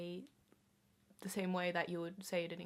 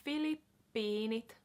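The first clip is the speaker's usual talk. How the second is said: slow demo. Shown as a demonstration. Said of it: "repeating word for learners".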